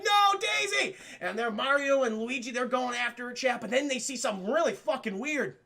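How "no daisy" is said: mock cry out. Luigi cries out after kidnapped Daisy.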